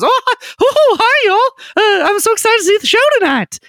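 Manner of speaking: very high, squeaky excited newsboy; excited paper boy. Acted. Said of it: "Papers the Paper Boy who".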